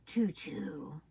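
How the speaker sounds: whispered train sound; whispering. Pretend. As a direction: breathe the choo-choo softly. Playing train with toddler.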